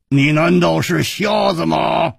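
slow, angry glaring shout. A narrator's reading. Hu Tiehua glaring at the peddler, angry. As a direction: glare and snap the question. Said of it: "瞪着眼睛说".

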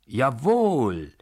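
JAWOHL shouted with a long stretched O; full-force shout. A narrator's reading. Narrator suddenly defies the baron, drawn-out shout. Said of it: "schrie ich plötzlich aus voller Kraft und dehnte das O".

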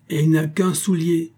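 indignant exclamation. A narrator's reading. Uncle turned terrible over the single shoe, exclaiming. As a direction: exclaim it, pitch lifted, mildly outraged. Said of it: "s'écrie le meilleur des oncles".

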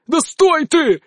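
frustrated yell at someone getting away; exasperated shout. A narrator's reading. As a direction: call out in exasperation after a fruitless chase. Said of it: "закричал он через пять минут".

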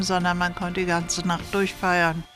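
usual speaking voice

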